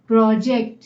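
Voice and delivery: "Project" with a strong opening pro; teacher's demo of "project", stressed on pro; stress on first syllable. Shown as a demonstration. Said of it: "मैंने first syllable पे ज्यादा जोर दिया".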